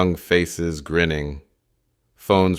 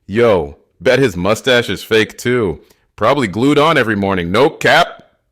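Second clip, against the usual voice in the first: loud, higher, cocky jeer; emboldened; 5 semitones higher, 7 dB louder than usual. A narrator's reading. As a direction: pipe up boldly so the crowd hears. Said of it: "finds his voice".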